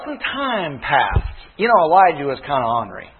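usual speaking voice

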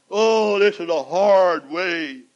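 a touch of whiny complaint; mock moan. Preacher imitating a complainer, lightly whiny. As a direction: sigh it out like a grumbler.

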